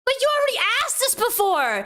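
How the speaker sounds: high-pitched exasperated protest; incredulous. Real, not acted. Streamer catching him repeating his question, incredulous.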